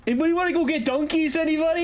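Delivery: high, put-on Boston accent; exaggerated high Boston-accent shout-out. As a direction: call out in a hammed-up Boston accent. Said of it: "nobody likes the Boston accent".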